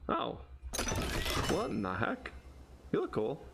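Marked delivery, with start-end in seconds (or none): none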